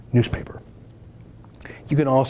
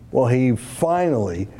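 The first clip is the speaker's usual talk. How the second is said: normal talking voice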